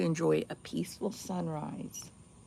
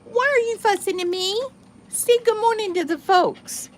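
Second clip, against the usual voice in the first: high pet talk; 13 semitones higher, 11 dB louder, slower than usual. Real, not acted. Woman greeting her dog for the folks, in high loud baby talk.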